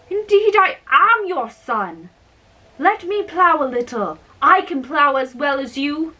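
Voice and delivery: a hint of a pleading son; insistent young giant. A narrator's reading.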